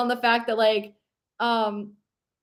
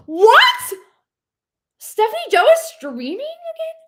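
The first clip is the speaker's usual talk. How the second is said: high, loud gasp of disbelief; real surprise; 12 semitones higher, 8 dB louder than usual. Real, not acted. Streamer reading news of a friend streaming, astonished. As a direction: squeal it, thrilled and astonished.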